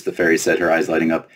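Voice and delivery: ordinary delivery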